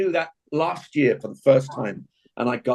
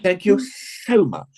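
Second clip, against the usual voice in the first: slow, higher, gushing English thanks; exaggerated English accent; 6 semitones higher, slower than usual. Shown as a demonstration. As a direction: overdo the English accent, drawing out "so". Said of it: "saying what I say when a waiter".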